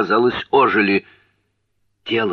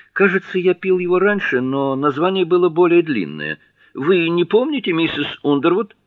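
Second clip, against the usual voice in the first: high, louder voice of an eager guest; Jherek, eager guest; 10 semitones higher, 5 dB louder than usual. Acted. Jherek asks Mrs Underwood about a tea's name, high and eager. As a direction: lift the voice brightly, politely curious.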